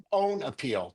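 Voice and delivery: unimpressed, matter-of-fact "own appeal"; rival's version. Shown as a demonstration. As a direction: say "own appeal" evenly, without drawing it out. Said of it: "Dan likes to call it".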